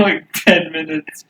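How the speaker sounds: normal talking voice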